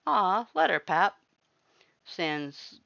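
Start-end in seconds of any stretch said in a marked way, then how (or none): 0.1-1.2 higher, louder coaxing plea; coaxing brother. A narrator's reading. A brother coaxes Papyrus, louder and higher. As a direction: coax Pap to give in.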